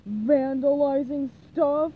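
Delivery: loud, slow, dopey reply; slow, emphatic character voice; Patrick. A narrator's reading. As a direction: answer loudly, drawing the words out. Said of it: "he answered".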